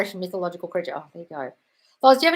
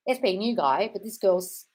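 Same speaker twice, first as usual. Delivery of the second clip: ordinary talk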